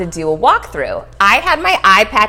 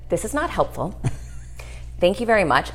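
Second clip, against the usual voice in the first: 1 dry sarcasm; 7 dB quieter than usual. Retold or imitated. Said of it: "and I said".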